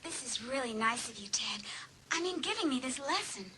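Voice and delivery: soft, breathy flirting whisper; intimate whisper. Acted. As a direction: lean in and whisper sweetly. Hallie flirts with Ted softly.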